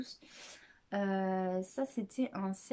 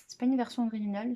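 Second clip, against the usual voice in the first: ordinary talk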